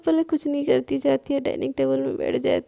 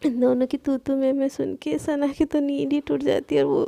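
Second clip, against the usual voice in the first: ordinary delivery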